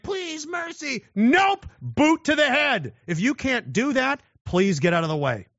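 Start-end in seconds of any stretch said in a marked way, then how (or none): 0.0-1.0 high, thin pleading whine; mock a begging enemy, soft and slow; whimpering plea for mercy, hammed up. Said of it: "as your enemy's like". 1.1-2.9 loud, high, slow; loud cartoonish finishing blow; mock brutal refusal. Mock execution of enemy.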